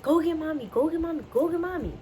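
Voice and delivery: urgent high plea to the dog; desperate pleading. Retold or imitated. Begging dog to fetch mom.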